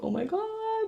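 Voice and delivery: put-on a teenager's giddy "oh my God"; giddy gasp. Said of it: "I'm like".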